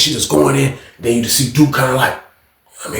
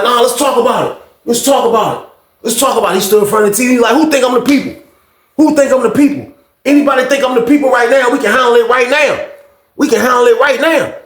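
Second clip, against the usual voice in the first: higher repeated dare; retold dare; 9 semitones higher than usual. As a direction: dare anyone to speak up. Duke daring anyone.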